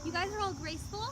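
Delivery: put-on soft, gentle princess voice talking to children; storytelling queen speaking softly to kids; Elsa character voice. Plays Elsa at a kids' party.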